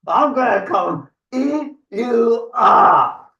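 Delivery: high, slow troll threat; menacing troll. Acted. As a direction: stretch the threat out to a big finish. Troll threatens to eat Gruff.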